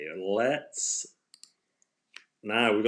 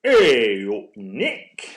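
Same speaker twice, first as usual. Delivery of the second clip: loud, stretched HEY in a mock regional accent; exaggerated accent demo, dropping back on the name; put-on regional accent; 10 dB louder, much slower than usual. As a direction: belt out the first word in a put-on local accent. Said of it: "It could be like this".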